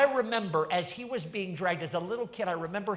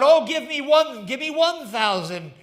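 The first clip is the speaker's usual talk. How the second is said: auctioneer crying out; 8 dB louder than usual. Acted. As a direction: rattle off the bids to the crowd. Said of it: "And the people cried out".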